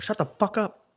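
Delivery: high-pitched mock telling-off; retold urgent shushing. Retold shushing of a loud fan.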